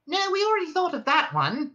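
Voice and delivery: high, quick interrupting mouse; Frankie mouse voice. A narrator's reading. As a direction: jump in impatiently.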